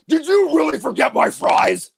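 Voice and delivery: loud, drawn-out indignant demand; [name]'s B-side voice. Acted. Sister scolding someone over forgotten fries, mock indignant shout. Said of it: "the voice will drop".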